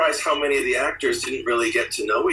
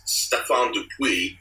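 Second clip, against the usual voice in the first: ordinary delivery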